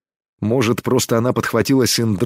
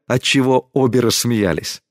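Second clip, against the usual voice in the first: ordinary delivery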